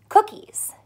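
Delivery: ordinary talk, nothing marked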